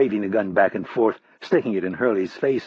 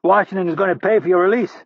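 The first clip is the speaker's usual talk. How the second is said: much higher, loud call across the room; yelled; 9 semitones higher, 5 dB louder than usual. Acted. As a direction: shout to reassure him. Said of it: "Rapp saw Hurley and yelled".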